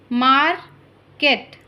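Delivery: slow, drawn-out dictation of market; slow careful model word. Shown as a demonstration. Teacher modelling market slowly for listening pupils. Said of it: "listen to your teacher say the following words".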